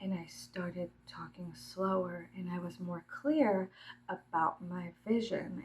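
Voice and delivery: soft, slow, properly enunciated speech; slow, clear enunciation. Shown as a demonstration. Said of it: "started enunciating my words properly".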